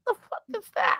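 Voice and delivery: scared. Retold or imitated. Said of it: "I was like".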